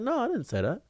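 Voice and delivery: high-pitched, softened mock innocence; feigned innocent denial. Man retelling denial to asset protection, feigned innocence. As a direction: deny it lightly, way up high.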